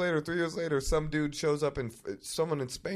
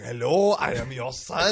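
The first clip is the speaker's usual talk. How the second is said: put-on French accent; slower than usual. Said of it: "with a French accent".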